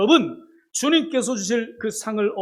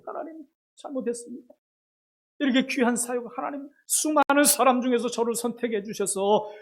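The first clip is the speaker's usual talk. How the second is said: high, pleading confession, a touch of sobbing; mild tearful repentance; 4 semitones higher, 6 dB louder than usual. Retold or imitated. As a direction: beg forgiveness half in tears. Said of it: "엉엉 울면서 회개하는".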